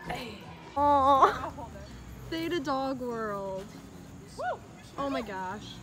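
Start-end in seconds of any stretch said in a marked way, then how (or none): none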